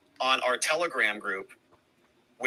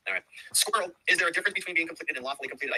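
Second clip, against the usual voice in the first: ordinary talk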